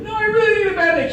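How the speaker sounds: put-on high whiny little-girl plea; mock tearful begging, pitched high; imitating a crying child. Daughter pleading with dad for a Band-Aid, mock whiny high plea. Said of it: "She starts crying".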